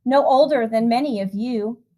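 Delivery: lilting storybook reading voice; rhythmic, gentle picture-book narration; reading to children. A narrator's reading. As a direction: read the rhyme aloud to a room of kids. Reads a rhyming picture book.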